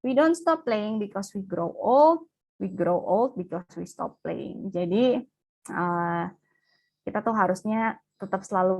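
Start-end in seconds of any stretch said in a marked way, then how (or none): 0.0-4.8 slow, deliberate quotation; quote read out. Shown as a demonstration. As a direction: let the motto land slowly. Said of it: "ini slide terakhir". (membacakan kutipan, pelan dan mantap)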